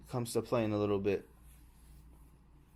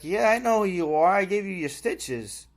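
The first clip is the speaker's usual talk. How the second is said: cheerful nurse; 7 semitones higher, 5 dB louder than usual. Retold or imitated. Nurse greets him cheerfully.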